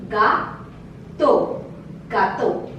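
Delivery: gâteau said ga-toe. Shown as a demonstration. Teacher showing AU sounds O in gâteau. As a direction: finish gâteau on a pure O. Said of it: "produces the sound O".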